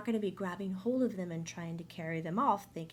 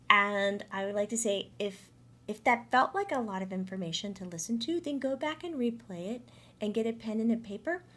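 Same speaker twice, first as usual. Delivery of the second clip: ordinary talk, nothing marked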